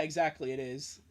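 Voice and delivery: normal talking voice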